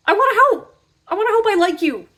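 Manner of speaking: high squeaky hammed-up plea; pleading helper. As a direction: plead sweetly to be liked.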